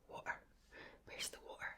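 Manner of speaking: "water" said wa'er, with a glottal stop; dropped T. Shown as a demonstration. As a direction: swallow the T in "water". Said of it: "we can sort of miss a lot of, you know, uh, letters".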